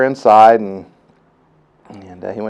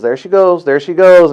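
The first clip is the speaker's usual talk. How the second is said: higher-pitched, lightly excited quote; excited announcement; 6 semitones higher, 5 dB louder than usual. Retold or imitated. Doctor to father as the treatment works, excited. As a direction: imitate the doctor seeing it finally work.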